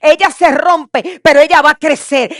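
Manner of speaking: loud, rising sermon build; fervent preaching. Acted. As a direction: preach it with full fire. Preaching at full force.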